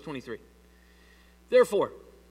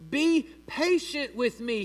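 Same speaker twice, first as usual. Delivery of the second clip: slow, higher-pitched plea; begging; 7 semitones higher, 4 dB louder, slower than usual. A narrator's reading. Fellow servant pleading for more time, begging. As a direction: draw out the plea, lightly acted. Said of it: "He begged him".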